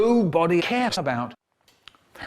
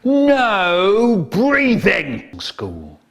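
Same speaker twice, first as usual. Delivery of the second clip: slow odd voice; 3 semitones higher, 4 dB louder, much slower than usual. Acted. Absurd self introduction.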